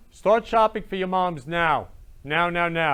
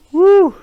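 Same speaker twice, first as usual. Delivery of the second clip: very loud, high-pitched whoop; sudden piercing whoop of emotion; 16 dB louder than usual. Real, not acted. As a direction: shake off the tears with a big "woo". Shaking off oncoming tears.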